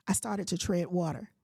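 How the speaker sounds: normal talking voice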